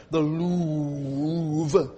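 Louvre said the French way, soft and low; half-muttered French Louvre. Shown as a demonstration. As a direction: try the Louvre like a Parisian. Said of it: "French, man".

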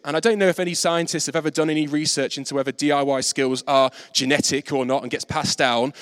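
ordinary delivery

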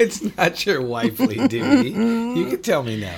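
high laughing protest. Real, not acted.

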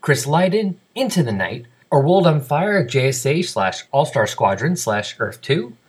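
brisk, emphatic podcast announcer delivery; scripted announcer read. Acted. Host reads feedback and listener names to the audience, announcer style. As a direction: read it out crisply with swinging, sing-song list intonation.

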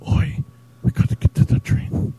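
a whispered Liam, lines barely voiced; very quiet Liam impression. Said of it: "Very quiet".

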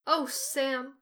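a pained cry of Sam's name; cried out. Acted. Frodo cries out to Sam in remorse, pained cry. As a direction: call out to Sam in anguish. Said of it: "cried Frodo".

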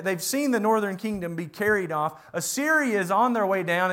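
normal talking voice

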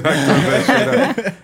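laughing, slow and high; drawn out with laughter. Real, not acted. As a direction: laugh through the words. Laughing telling story.

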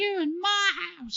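very high mock yell; high-pitched imitated holler; homeless old man. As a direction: squawk it like an indignant old man. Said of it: "that homeless old guy that's, like, knocking on".

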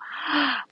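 sharp high mock gasp; shocked gasp. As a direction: gasp like an alarmed mom. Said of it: "we go".

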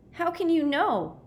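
high, distressed question; anguished disbelief, voice leaping up; despairing challenge. A narrator's reading. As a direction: throw it back in high-pitched despair. Despairing boy challenges his friend's faith.

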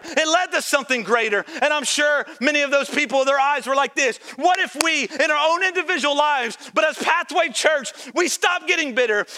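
loud, high, driving sermon delivery; impassioned church preacher in full cry; full-force preaching. Acted. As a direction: preach it at the top of your voice, punching each phrase.

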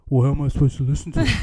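loud, quick mock grumble; pissy complaint. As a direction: imitate a huffy DJ complaining. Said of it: "like, really pissy".

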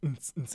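mouthed club beat, short repeated pulses; imitated EDM beat. Telling a friend about the tanning bed's music, imitated EDM beat. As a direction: imitate thumping dance music with the voice. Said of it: "it was, like, some fun, like, you know, just gen-, generic E D M".

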